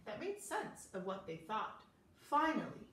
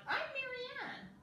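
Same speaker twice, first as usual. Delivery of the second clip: higher, slow little duck voice. Acted. Duck Marianne introducing herself in a high, slow voice. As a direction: sound like a sweet small duck.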